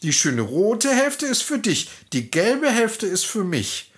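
fake-friendly farmer's wife; disguised peasant woman. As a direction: play along sweetly.

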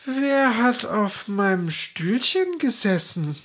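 a small dwarf asking crossly who sat here; grumpy dwarf, high voice; frowning dwarf. A narrator's reading. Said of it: "Der erste Zwerg runzelte die Stirn".

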